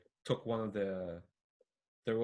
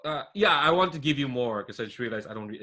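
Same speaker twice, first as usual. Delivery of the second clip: ordinary talk, nothing marked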